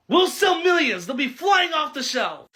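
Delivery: loud, high sales hype; mock boss cheering big sales; hyped boss. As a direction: shout it like a thrilled executive. Boss hyping the product.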